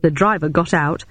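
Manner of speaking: ordinary delivery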